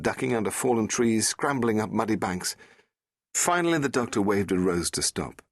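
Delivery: ordinary delivery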